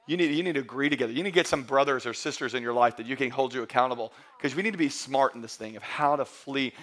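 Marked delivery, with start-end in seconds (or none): none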